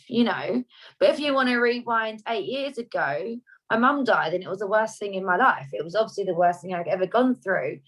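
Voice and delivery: ordinary delivery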